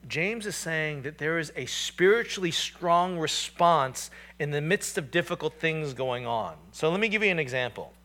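normal talking voice

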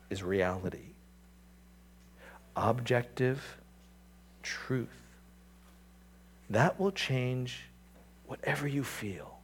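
hushed solemn emphasis. Acted. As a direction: let each word land in near hush.

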